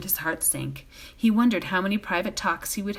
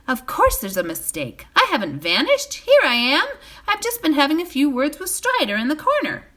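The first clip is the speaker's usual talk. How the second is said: higher, louder bright reappearance; cheery Frodo; 8 semitones higher, 6 dB louder than usual. A narrator's reading. Frodo stepping out to the startled room, bright and loud. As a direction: announce yourself brightly.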